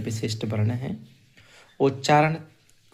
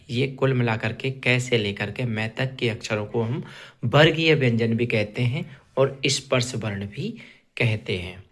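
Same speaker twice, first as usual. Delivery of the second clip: ordinary talk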